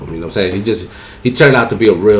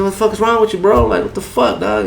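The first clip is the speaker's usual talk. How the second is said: high, heated retold challenge; heated what is wrong with you; angry demand; 13 semitones higher than usual. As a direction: call him out hard. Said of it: "I said".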